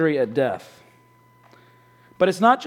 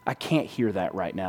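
quiet bitter retort; 10 semitones lower, 7 dB quieter than usual. Retold or imitated. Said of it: "And he just says".

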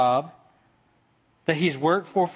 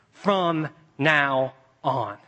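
"From now on" stretched very slowly; much slower than usual. Shown as a demonstration. Preacher ending on the words, very slowly. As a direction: draw each word out. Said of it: "three little words".

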